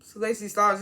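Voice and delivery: ordinary talk, nothing marked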